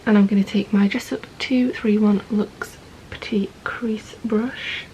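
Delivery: ordinary delivery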